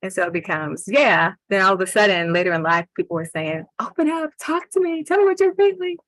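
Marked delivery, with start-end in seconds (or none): 3.8-6.0 urging voice. Retold or imitated. People urging him to open up.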